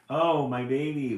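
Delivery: loud mock wail; mother bird wails, loud but lightly acted. A narrator's reading. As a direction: lament her stolen chick. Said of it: "wails Mama Jay".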